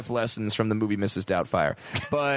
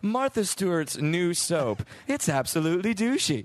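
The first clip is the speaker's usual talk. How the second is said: mock ad read; 5 semitones higher than usual. Announcer reading a tagline for a soap, mock ad read. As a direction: sell it like a soap advert. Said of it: "the tagline for a soap".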